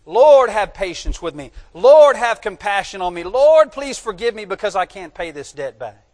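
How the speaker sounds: loud, acted-out begging; impassioned plea. Indebted servant begging his lord to forgive the debt, loud plea. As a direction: cry out to the master for mercy.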